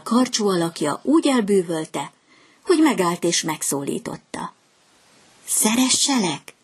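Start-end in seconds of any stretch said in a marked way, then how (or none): 5.5-6.5 light, higher swallow voice asking shyly; a small bird asking sweetly; little swallow asking. A narrator's reading. Swallow shyly asks the reed to love her, higher voice. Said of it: "kérdezte a fecske".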